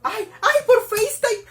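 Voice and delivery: high, loud burst of excitement; loud, high-pitched fangirl outburst; thrilled squeal. Real, not acted. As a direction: squeal it like a delighted fan. Thrilled that he video-calls.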